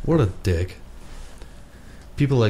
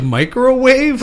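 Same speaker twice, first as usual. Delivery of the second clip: baffled question; 5 dB louder, slower than usual. Pretend. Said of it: "I would have been like".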